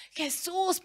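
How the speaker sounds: "Jesús" lifted high like a cry; calling out Jesus. Shown as a demonstration. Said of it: "pero realmente con toda tu fuerza".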